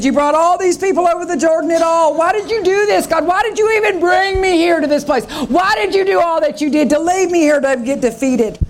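high, wounded accusing voice; Joshua complaining. Acted. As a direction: demand answers from God in distress. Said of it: "And he said".

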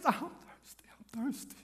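faint breathless whisper fading away; weak whisper. Acted. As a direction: gasp it out, parched and dying. Dying Jesus on the cross.

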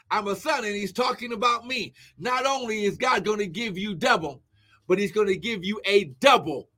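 normal talking voice

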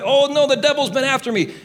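high-pitched mock fretting; mock panic. Mocks a fearful believer.

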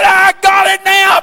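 retold joyful squall; joyful shout. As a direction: let it burst out like the weight just lifted. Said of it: "he squalled right loud".

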